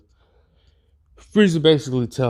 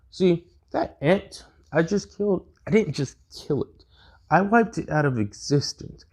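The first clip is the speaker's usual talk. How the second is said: higher, lightly voiced villain, cold and gloating; a touch of smug tyrant, pitched up; Frieza voice; 8 semitones higher than usual. Frieza gloating after killing ant.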